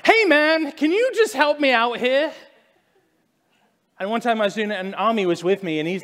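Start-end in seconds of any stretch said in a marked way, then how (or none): 0.0-2.3 high, loud, pleading call to God; half-joking loud plea while walking by water; loud prayer. Retold or imitated. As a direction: shout it up to the sky. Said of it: "Usually it's like".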